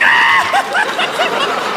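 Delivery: high screeching cackle; hysterical laughing. Acted. Cop dying at the joke, high screechy laughing. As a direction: burst into a shrieking laugh. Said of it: "The cop starts dying".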